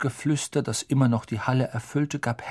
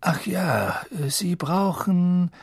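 whispered, slowed "Ach ja"; 7 semitones higher, much slower than usual. Acted. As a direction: trail off gently as if remembering. Dumbledore's afterthought.

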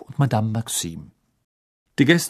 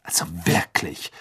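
low, disapproving sniff; hushed, low disdain; disdainful; 6 semitones lower, 3 dB quieter than usual. A narrator's reading. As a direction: say it with your nose in the air. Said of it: "sagte Hermine naserümpfend".

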